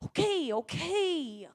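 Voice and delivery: slow, reluctant giving in; sulky teen giving in; grudging okay. Retold or imitated.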